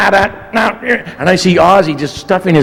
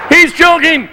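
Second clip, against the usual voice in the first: much higher, urgent cry; alarmed shout; 12 semitones higher, slower than usual. Retold or imitated. Bandmate retelling his panicked cry, high alarm. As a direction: yell for help. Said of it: "And I went".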